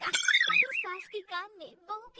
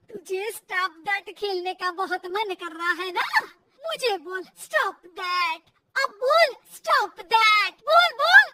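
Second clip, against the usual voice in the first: angry goading; 3 semitones lower, 9 dB louder than usual. Acted. Mother-in-law dares daughter-in-law to say it, angry goading. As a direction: dare her, building louder to the end. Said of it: "सास को फिर बहू पर गुस्सा आ जाता है".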